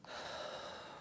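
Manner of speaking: long tearful sigh out; shaky sigh. Real, not acted. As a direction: sigh after crying. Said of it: "I did get teary".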